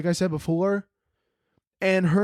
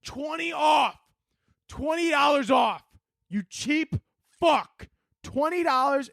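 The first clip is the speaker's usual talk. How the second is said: high, shouted sales pitch; company shouting; 10 semitones higher than usual. Retold or imitated. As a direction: yell the discount like a pushy rep. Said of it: "they go".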